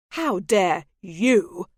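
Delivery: slow, loud, falling accusation; slow fury. Acted. The lord turns her outrage back on her, slow and loud. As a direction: lean into each word, furious.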